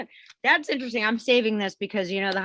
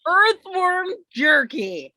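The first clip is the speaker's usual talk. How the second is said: drawn-out disgust; 8 semitones higher, 3 dB louder, much slower than usual. Real, not acted. Disgusted reaction to worms.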